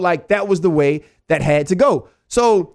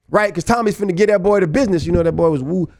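ordinary delivery

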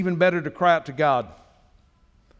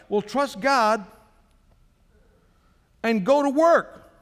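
fervent preaching; 6 semitones higher than usual. Acted. Pastor urges idle believers to go work, rising preaching. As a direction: push the listeners to get up and act.

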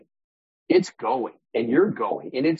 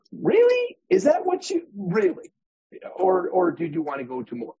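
incredulous questioner; 8 semitones higher than usual. Acted. Voicing a doubting questioner.

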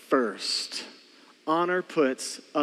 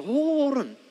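higher, drawn-out "hold on"; halting interjection; 8 semitones higher than usual. Acted. Dad to kids pushing back, halting them. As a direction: stretch it out high to stop them. Parent halts child's push-back.

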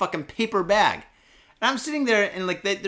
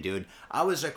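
ordinary talk, nothing marked

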